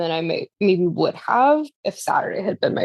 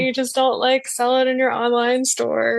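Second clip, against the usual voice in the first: high, bright-eyed polite plea to an artist, raised higher; asking politely; 8 semitones higher than usual. Retold or imitated. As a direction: put on a sweet, hopeful asking voice, pitched up. Acting out a polite request to an artist.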